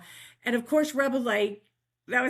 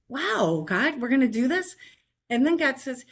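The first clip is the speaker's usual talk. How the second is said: usual speaking voice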